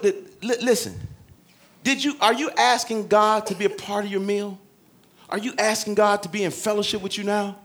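ordinary talk, nothing marked

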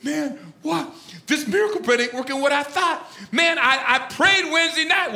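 high, baffled mock complaint; comic indignant griping, high; griping disciple. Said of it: "And goes".

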